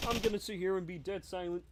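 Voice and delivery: ordinary talk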